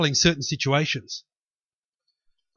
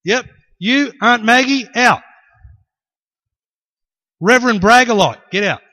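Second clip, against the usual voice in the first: loud, high call picking people out; bossy order; 6 semitones higher, 9 dB louder than usual. Acted. Jesus clearing out the relatives, loud bossy order. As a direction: order them out one by one.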